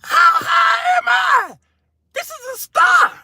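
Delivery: high drawn-out panicked wail; comic panic. Retold or imitated. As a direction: shriek it in mock terror. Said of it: "I said".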